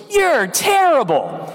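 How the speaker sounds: put-on high, slow little-boy jeer; mock a kid's taunt by stretching it out; bratty boy taunt. Young boy jeering at the teacher's bad throw, high and drawn out. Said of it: "the boy goes".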